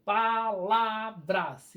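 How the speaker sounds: palavras stretched into pa-la-vras; long, measured beats on "palavras"; syllables drawn out. Shown as a demonstration. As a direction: slowly sound out each syllable of "palavras". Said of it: "Então, a sílaba tônica é".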